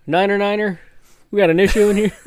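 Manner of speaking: loud, high radio call; crackly military radio. Retold or imitated. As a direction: bark it into a field radio. Calling over military radio.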